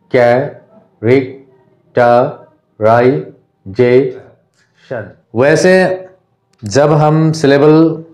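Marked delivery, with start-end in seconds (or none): 0.1-5.1 characterisation extremely slowly, long pause after each syllable; six lone beats: कै... रेक... ट... राइ... जे... शन; isolated syllables. Shown as a demonstration. Teacher repeating the word to count chin drops, isolated syllables. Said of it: "छः बार हुआ".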